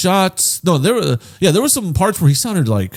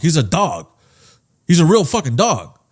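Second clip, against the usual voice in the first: normal talking voice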